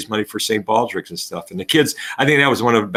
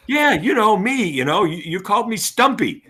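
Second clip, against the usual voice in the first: put-on kid's voice; 10 semitones higher than usual. Student voiced in classroom story.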